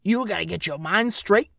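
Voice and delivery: loud, brash Bronx threat; New York accent. Acted. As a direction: push it like a tough New Yorker. New Yorker threatens the Texan.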